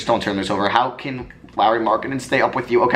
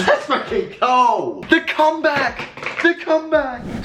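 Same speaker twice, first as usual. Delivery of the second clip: much higher, slow drawn-out victory cheer; triumphant celebration; 13 semitones higher, slower than usual. Real, not acted. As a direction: celebrate making the Finals. Winning game seven.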